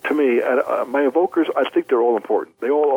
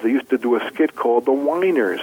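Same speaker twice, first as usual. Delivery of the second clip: normal talking voice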